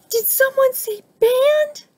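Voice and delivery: very high, piping bird voice; excited bird. A narrator's reading. Bird bursts in on Snake, excited high piping. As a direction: pipe up eagerly, way up high. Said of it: "Bird twirled like a top".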